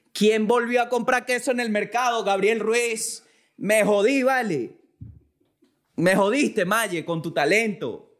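mock shouting. Real, not acted. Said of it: "No sé por qué estoy gritando".

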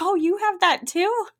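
high, slow delighted question; excited. Retold or imitated. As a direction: gush with surprised delight. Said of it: "I got really excited".

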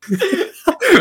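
risa alta y desatada; carcajada aguda. Real, not acted. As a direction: se le escapa la risa. Se ríe tras repetir. (carcajada aguda tras repetir la pregunta)

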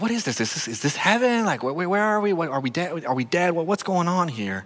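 put-on bewildered children. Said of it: "they're like".

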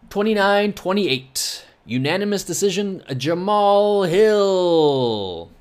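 playful ring-announcer call. Pretend. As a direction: announce the verdict, building to a drawn-out name. Announcing the predicted fight decision.

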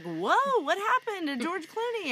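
high surprised whoa, playing clueless; bright clueless wife asking about the show; mock surprise. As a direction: pop in and act baffled. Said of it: "I'll be like".

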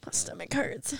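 soft, worn out from laughing; weak from laughing. Real, not acted. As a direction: barely get it out after a laughing fit. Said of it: "You're crying right now".

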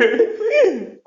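sudden high cackle; real laugh at the joke; high laugh burst. Real, not acted.

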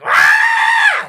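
huge high shouted yell; [name] yell. Retold or imitated. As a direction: let out a wild shriek. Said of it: "one of those [name] yells".

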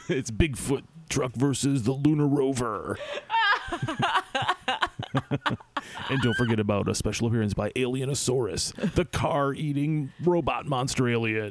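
laughing hype promo; monster truck ad. Pretend. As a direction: announce the monster lineup.

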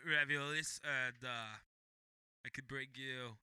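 congested neutral voice. Pretend. Offering food to the co-host, congested put-on voice. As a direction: play a stuffed-up, indeterminate speaker. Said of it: "just congested voices".

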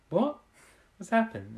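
sudden high what; shocked. Retold or imitated. Soldiers stunned the missiles did nothing, high-pitched shock. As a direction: shoot up in pitch on the first word.

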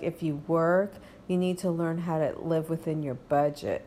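usual speaking voice

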